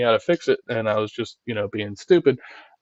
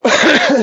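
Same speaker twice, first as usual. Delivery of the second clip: big loud laugh up high; loud high cackle of disbelief; 14 semitones higher, 6 dB louder than usual. Real, not acted. Laughing at ridiculous drama.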